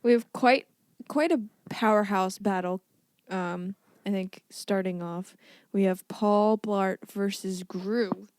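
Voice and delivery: lightly hyped, slow matchup announcement; show announcer. Acted. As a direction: present the battles like a lightly hammed-up fight announcer.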